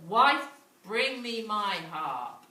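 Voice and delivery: giant's order. Acted.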